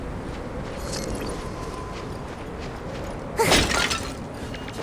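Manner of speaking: long, soft unvoiced sigh; weary sigh. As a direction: breathe out the hopelessness. Sigh at the cluttered room.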